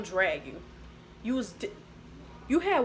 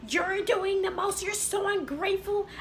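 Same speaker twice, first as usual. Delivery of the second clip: hammed-up high-pitched reproach; mock indignant tirade; 10 semitones higher than usual. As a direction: lay on the guilt in a high mocking voice. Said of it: "after she did all that screaming".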